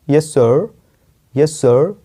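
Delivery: सर with American curled-tongue R. Shown as a demonstration. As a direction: say सर the American way, "surr". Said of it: "American में बोल सकते हो जीभ को मोड़ के".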